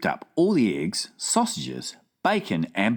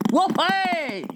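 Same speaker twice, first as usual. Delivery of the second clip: mock shout. Giant celebrating his whizpopper, whooping. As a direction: whoop with joy. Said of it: "shouted the B F G".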